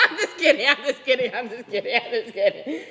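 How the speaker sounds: laughing through the words. Real, not acted. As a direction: giggle it out, teasing. Laughing after scaring viewer.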